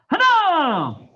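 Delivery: loud Korean count called to the class; shouted count. Real, not acted. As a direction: bark the count so the whole room hears. Instructor counting kicks for class.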